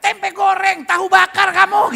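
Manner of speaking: very loud, high mock cursing; mock angry. Comic cursing someone with food names, shouted mock rage. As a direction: yell food names as if they were curses. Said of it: "kalau marah".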